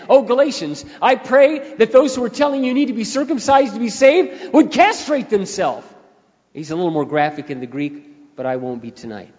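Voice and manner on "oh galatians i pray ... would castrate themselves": raised, forceful voice of Paul's rebuke; loud quoted outburst. Retold or imitated. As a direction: belt out the apostle's harsh wish. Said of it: "this was not unwholesome when he said".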